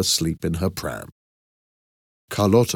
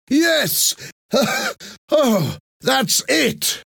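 very high, excited cry with a gasp; relieved shouting; much slower than usual. A narrator's reading. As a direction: exclaim in huge relief. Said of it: "shouted Professor Toledo".

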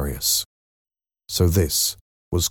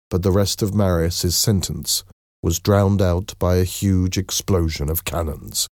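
ordinary delivery

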